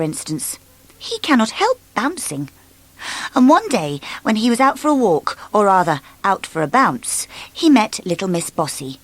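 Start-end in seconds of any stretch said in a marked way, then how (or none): none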